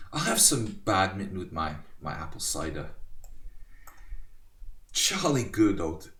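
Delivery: lightly mocked posh British accent; a half-hearted stab at a British accent. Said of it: "I can't do it".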